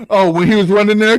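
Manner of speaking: usual speaking voice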